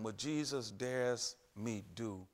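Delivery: ordinary talk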